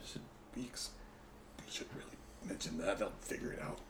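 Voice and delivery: hushed aside to the table; whispering. Real, not acted. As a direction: lean in and whisper it.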